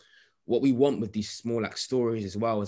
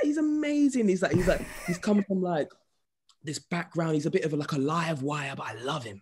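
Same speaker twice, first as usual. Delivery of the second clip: very high mum voice; high-pitched doting mum impression; imitating his mum; 8 semitones higher than usual. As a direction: squeak out his mum's praise.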